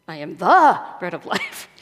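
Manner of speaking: exaggerated emphasis on "the"; stress on THE. Shown as a demonstration. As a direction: hit the word "the" hard. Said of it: "emphasizing different words in the same sentence".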